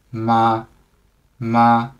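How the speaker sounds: "ma" held on one level pitch, no rise or fall; first tone. Shown as a demonstration. Teacher shows students the first tone, "ma" kept level. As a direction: say "ma" in a level, unchanging tone. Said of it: "a flat way".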